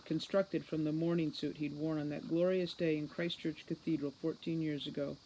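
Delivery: plain reading voice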